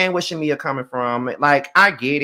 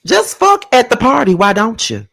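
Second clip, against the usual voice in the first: high, loud, exasperated outburst; loud exasperation; 9 semitones higher, 8 dB louder than usual. Real, not acted. Recapper reacting to a couple moaning at a party, loudly exasperated. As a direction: call them out, loud and fed up.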